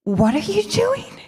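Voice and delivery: high-pitched baffled question. Retold or imitated. Retelling a reaction to college stories, high mock bafflement. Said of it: "I was so confused".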